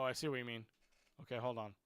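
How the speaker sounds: normal talking voice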